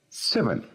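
seven with the stress on "se"; seven, first syllable stressed, falling tune. Shown as a demonstration. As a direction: lean on the start of seven. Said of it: "El acento está en la primera sílaba".

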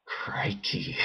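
unvoiced breathy crikey; whispered exclamation. Real, not acted. Gamer after a long stealth stretch, whispering crikey. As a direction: breathe it out under your breath.

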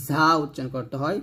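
ordinary talk, nothing marked

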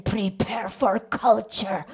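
booming, slow announcer; grand announcer voice. Acted. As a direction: announce culture like a grand master of ceremonies. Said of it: "There's that voice again".

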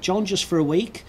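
ordinary talk

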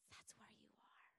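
a hushed aside after the shouting; sudden whisper. Real, not acted. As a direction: drop to an unvoiced whisper.

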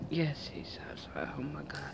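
hushed breathless whisper so the neighbor won't hear; sudden whisper. Real, not acted. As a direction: drop to a secret whisper, caught off guard. Neighbor outside again.

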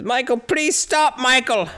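high, slow, imitated pleading; pleading Loomis. Doctor pleading with the killer, imitated high and slow. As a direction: beg him to stop, drawn out. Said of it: "He says".

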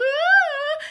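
loud, very high sustained "aah"; a piercing, drawn-out cry; mermaid's wail. A narrator's reading. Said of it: "The mermaid wailed".

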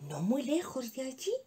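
ordinary delivery

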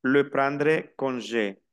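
le prendre congé said slowly, each syllable clear; teacher modelling French; slow French demo. Shown as a demonstration. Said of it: "ahora vamos lo que es las despedidas".

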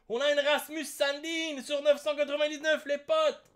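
excited reveal. Real, not acted. Excited rare card reveal.

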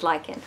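lichen said with a hard "k", LIKE-en. Shown as a demonstration. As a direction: pronounce lichen as "liken". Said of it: "Do you pronounce lichen, lichen or lichen".

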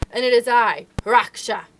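fierce defiance. Acted. Mother wolf facing the tiger over her cub, fierce shout. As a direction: snarl back at the tiger.

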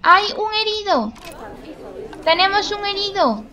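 high alarmed cry of surprise; man down shout. Real, not acted. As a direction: call out the injured one in alarm. Spots an injured lemur.

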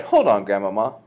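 loud, urgent halt; loud interruption; crying out. A narrator's reading. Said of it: "I cried".

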